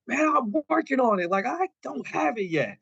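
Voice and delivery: high, strained-up retort to nagging; mock exasperation. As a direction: imitate a harried man fending off a pest. Acted retort to nagging cousin mid-match.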